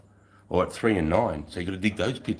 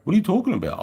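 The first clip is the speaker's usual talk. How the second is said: indignant retort; 12 semitones higher, 7 dB louder, faster than usual. Retold or imitated. Retort repeated louder.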